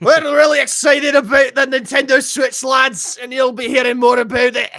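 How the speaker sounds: loud, high mock Scottish accent, shouting to the lads; hammed-up Scottish accent announcing big news; put-on Twitch accent. Streamer in a chat-requested accent hypes the Switch, mock Scottish shout. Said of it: "I'll just talk like this for the rest of the time".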